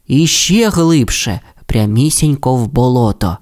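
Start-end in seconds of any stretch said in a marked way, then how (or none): none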